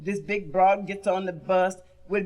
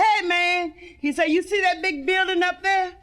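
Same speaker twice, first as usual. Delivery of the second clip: wino voice; 7 semitones higher than usual. Acted. Wino talking to buddy.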